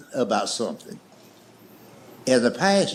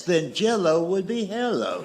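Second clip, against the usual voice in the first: ordinary delivery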